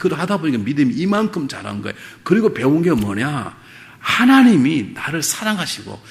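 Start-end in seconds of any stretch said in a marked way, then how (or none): 4.0-6.0 preaching at full force. Acted. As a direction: build to a ringing proclamation. Sermon climax.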